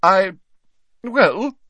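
high, loud, flustered stammer; defensive. A narrator's reading. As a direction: hedge with a long pause. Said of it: "drawing her shawls around her defensively".

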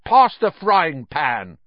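loud, high, drawn-out demand; slow, rude command; bratty order. A narrator's reading. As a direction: order it like a spoilt boy. Greedy son orders his cousin.

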